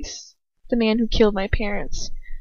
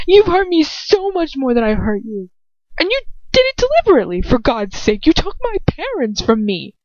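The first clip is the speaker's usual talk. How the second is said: much higher, raised accusation; rising fury at him; heated accusation; 7 semitones higher than usual. A narrator's reading. Hermione accusing Lucius of killing her parents.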